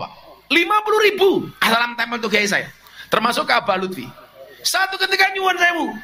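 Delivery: preacher urging the congregation to honour their teachers, fervent; fervent preaching. Acted. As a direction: preach it with rising fervour to the congregation. Impassioned part of the sermon.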